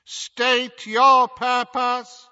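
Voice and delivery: high, slow metallic gate voice; clanging echoing voice. A narrator's reading. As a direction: boom like an iron gate speaking. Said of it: "spoke in a clanging, echoing voice".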